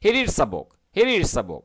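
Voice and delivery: 'He reads a book' run together as 'heereedzabook'; linked together. Shown as a demonstration. Teacher drilling learners, 'He reads a book' connected in one go. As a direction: join 'reads a' into 'readza'. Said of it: "আপনি এইভাবে বলতে থাকবেন".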